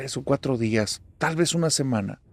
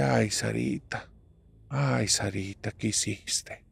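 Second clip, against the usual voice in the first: slow, hushed lament, sinking almost to a whisper; soft, drawn-out dread, fading away; hushed dismay; 8 dB quieter, slower than usual. Acted. Mother to daughter after her confession, in hushed dismay.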